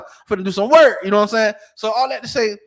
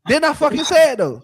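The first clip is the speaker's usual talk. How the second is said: higher, louder challenge; insistent demand; 8 semitones higher, 6 dB louder than usual. Real, not acted. Pressing the friends to confirm he said it, loud and high. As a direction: push the friends to admit it. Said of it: "insisting again".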